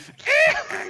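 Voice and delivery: high shrieking goblin cackle; goblin psycho laugh. Pretend. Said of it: "full psycho noise, but in a goblin voice".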